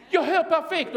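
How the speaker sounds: high, loud, imitated cry of joy; amazed cry. Healed girl crying out to the room, amazed cry. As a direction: call it out high, as if just discovering it. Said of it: "Hon bara ropar till så här".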